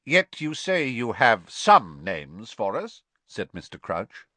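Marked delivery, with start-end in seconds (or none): 0.0-3.0 higher, louder firm demand; pressing the witness; curt official. A narrator's reading. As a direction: Crouch pressing Karkaroff. Crouch presses again.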